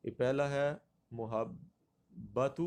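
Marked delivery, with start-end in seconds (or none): 1.1-2.6 محبت slowly as "mu-hab-batu", u first; pronunciation option. Shown as a demonstration. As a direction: say محبت split up, starting "mu". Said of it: "غلط پڑھا جاتا ہے".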